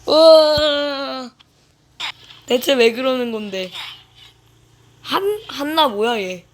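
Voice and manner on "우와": long, loud, stretched amazement; drawn-out loud wow. Real, not acted. Gamer stunned by the chaos, long loud wow. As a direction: let out a huge slow wow.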